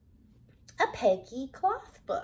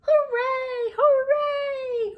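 much higher, louder cheering clown; joyful cheer; 14 semitones higher, 10 dB louder, much slower than usual. A narrator's reading. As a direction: shout it out in pure delight. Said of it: "cried the funny little clown".

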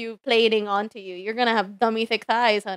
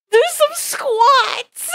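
mock voice; 13 semitones higher, 3 dB louder, much slower than usual. Sarcastic quoting chat.

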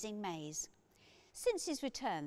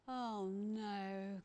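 groaning; 3 semitones higher than usual. A narrator's reading. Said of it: "groaned P J".

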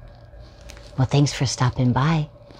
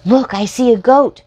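higher, louder excited call; excited spotting; 11 semitones higher, 9 dB louder than usual. Acted. Storyteller spotting the goat again, excited higher call. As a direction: point it out like a thrilled kid.

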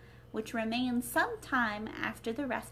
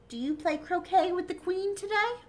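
higher, sly, sing-song Cat; Cheshire Cat voice; 8 semitones higher, 4 dB louder than usual. Acted. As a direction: toss the question out playfully. Cat asks Alice.

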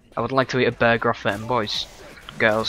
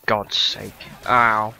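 ordinary talk

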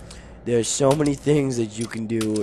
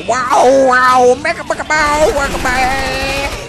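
high, slow mock garble; imitating gibberish; 11 semitones higher, 6 dB louder, slower than usual. Gamer mimicking a zombie's garbled words, high mock gibberish. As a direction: parrot the weird noise it made.

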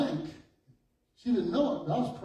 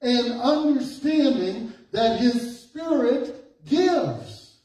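slow, high, emphatic preacher's reading; stretched-out pulpit proclamation; forceful scripture preaching; 6 semitones higher, 4 dB louder, much slower than usual. Real, not acted. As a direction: proclaim each phrase, drawn out and high.

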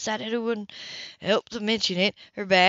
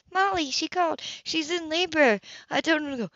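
higher, light woman's voice; female voice; 9 semitones higher than usual. Acted.

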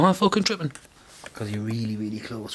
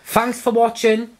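slightly higher, slow outro voice; lightly hammed-up YouTuber outro; mock sign-off; 7 semitones higher, 5 dB louder, slower than usual. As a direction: try out a cheesy sign-off. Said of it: "I could say, like".

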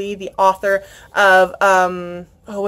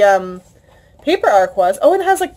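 normal talking voice

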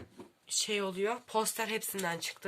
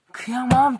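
"Kıyamam" repeated much louder and higher, gushing; 5 semitones higher, 13 dB louder than usual. Real, not acted. Booktuber gushing over her new bookmarks, loud "Kıyamam". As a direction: burst out with the word "Kıyamam" in delight. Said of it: "ben bunlara kıyamam".

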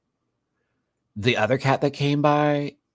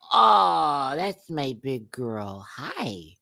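cooing at cat; 3 semitones higher, much slower than usual. Real, not acted.

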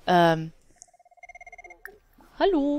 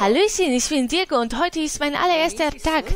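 eager rookie; 6 semitones higher than usual. Acted. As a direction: introduce yourself like a nervous new cop. Spricht als Diego, Spielfigur. (aufgekratzt begrüßend)

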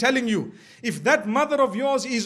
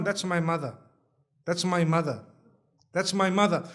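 passive son; 4 semitones lower, 3 dB quieter than usual. Retold or imitated. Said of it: "And the man just sits down".

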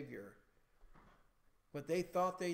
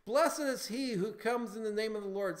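loud, raised-pitch acclamation; proclaiming; 5 semitones higher, 6 dB louder than usual. Acted. People proclaiming Jesus king, loud acclamation. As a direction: proclaim it boldly as the crowd. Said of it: "they were proclaiming him king".

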